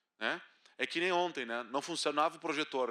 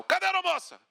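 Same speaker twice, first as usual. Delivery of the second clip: much higher, shouted demand; angry passenger outburst; 8 semitones higher, 6 dB louder than usual. Retold or imitated. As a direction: yell for the flight attendant. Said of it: "tu começa a fazer um escarcéu".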